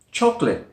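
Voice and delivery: "chocolate" as choc-lit; two-syllable chocolate. Shown as a demonstration. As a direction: drop the schwa in "chocolate". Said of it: "only say it with two".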